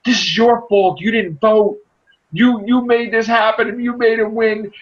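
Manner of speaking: high-pitched mock yell at his wife; imitated yelling. Husband on the show yelling at his wife, high mock yell. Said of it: "the guy's yelling at his wife".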